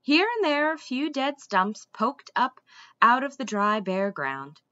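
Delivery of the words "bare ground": ordinary delivery, nothing marked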